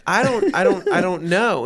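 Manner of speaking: feigned confusion. Retold or imitated. Dodging the wife who wants his seat, playing dumb. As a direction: act like you don't get the hint. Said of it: "and I'm like".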